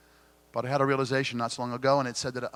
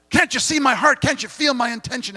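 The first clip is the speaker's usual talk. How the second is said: high, loud, pleading cry to be understood; pleading people-pleaser; 12 semitones higher, 9 dB louder than usual. Acted. Speaker voicing a pleaser begging to be understood, high pleading cry. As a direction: beg to be seen as a hurt pleaser. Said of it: "But for pleasers".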